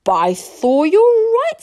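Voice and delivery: loud exclamation leaping high; amazed. Acted. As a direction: burst out in astonishment as the chief. Chief amazed by the discovery.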